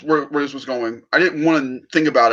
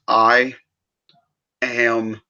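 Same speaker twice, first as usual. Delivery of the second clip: quiet and resolute; 3 semitones lower, 4 dB quieter, slower than usual. Retold or imitated. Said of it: "looks at him dead in the eye and goes".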